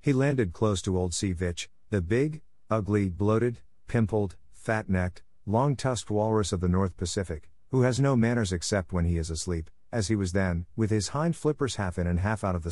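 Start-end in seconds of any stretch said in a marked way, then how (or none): none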